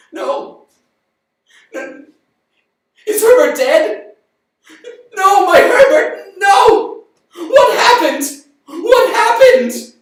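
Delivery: very high, loud, slow cries of grief; mother crying out at her son's death; anguished wail. Acted. Parents learn son is dead.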